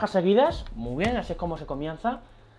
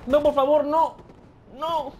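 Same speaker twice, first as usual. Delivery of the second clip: high, pleading no; desperate begging; 8 semitones higher, 3 dB louder, slower than usual. Real, not acted. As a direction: beg the game not to let you lose. Watching defeat approach.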